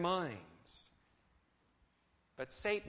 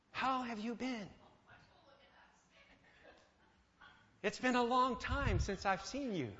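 a lifted, friendly greeting voice, somewhat higher; happy, courteous Lucifer; 5 semitones higher than usual. Acted. Lucifer greeting people, courteous and pleasant. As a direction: greet an old friend warmly and politely. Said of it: "Does Lucifer appear happy and courteous".

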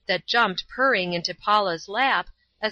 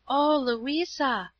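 slow, higher-pitched exclamation; gentle exclamation; 5 semitones higher, much slower than usual. A narrator's reading. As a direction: draw it out in heartfelt protest. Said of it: "exclaimed Paula".

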